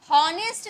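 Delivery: "हॉनेस्ट" opening on a pronounced h; wrong sounded H. Shown as a demonstration. Teacher repeating the misreading for students, "हॉनेस्ट" h-first. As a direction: sound the silent letter in "हॉनेस्ट". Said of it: "हॉनेस्ट नहीं बोलेंगे".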